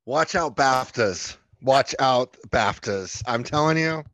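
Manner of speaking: loud, emphatic playful warning; mock warning. Host hyping up the guest's coming role, loud mock warning. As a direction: call it out loud like a boast.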